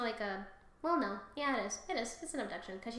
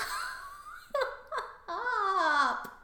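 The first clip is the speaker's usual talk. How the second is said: high laughing wail; 10 semitones higher than usual. Real, not acted. Owner trying to stop pet, long high laughing wail. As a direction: wail helplessly through laughter.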